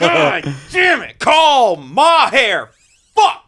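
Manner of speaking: high, slow, drawn-out yell; yelling dad. Retold or imitated. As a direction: shout the command at the phone.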